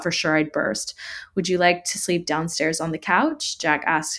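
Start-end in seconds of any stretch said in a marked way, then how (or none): none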